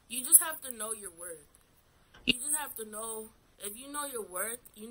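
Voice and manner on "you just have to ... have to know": ordinary talk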